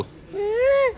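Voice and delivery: real whimpering sob, high and wavering. Real, not acted. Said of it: "ఎందుకు ఏడుస్తున్నావు?".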